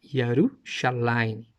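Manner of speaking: Yerushalayim drawn out slowly, every syllable clear; slow demonstration. Shown as a demonstration. Showing the audience the original name, slow and careful. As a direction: spell out Yerushalayim by voice. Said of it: "Olha só".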